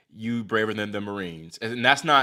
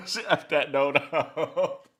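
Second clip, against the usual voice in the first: laughing; 6 semitones higher, slower than usual. Real, not acted. Laughs while watching the match.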